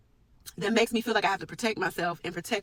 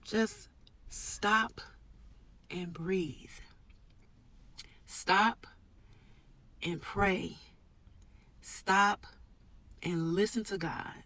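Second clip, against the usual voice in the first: hushed, with long pauses between phrases; soft, deliberate calm, nearly whispered on "breathe"; calming self-talk; 5 dB quieter, much slower than usual. Real, not acted. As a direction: settle yourself, one phrase at a time. Calming herself after anxious self-talk.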